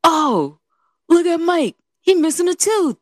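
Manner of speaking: high-pitched mock yell; imitated yell. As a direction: call it out like the uncle across a church. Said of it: "he just yells it in the church".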